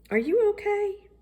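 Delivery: gentle fatherly question. A narrator's reading. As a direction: reach up gently to check on him. Daddy gently asks his son.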